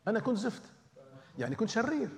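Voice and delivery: same phrase repeated up high, half teasing; Lebanese phrasing. Shown as a demonstration. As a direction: lift the voice on أنا كنت زفت like a playful confession. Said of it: "باللبناني عم أقولها".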